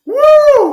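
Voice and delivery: long, loud, high "woo"; real goal-celebration whoop; celebratory whoop. Real, not acted. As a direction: let out a sustained high whoop.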